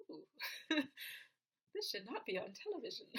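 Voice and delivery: ooh, laughing, mock-prudish; scandalized giggle. Vlogger reacting to a strip-club music video, scandalized laughing. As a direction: giggle through a playful pearl-clutch. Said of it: "but it's just like".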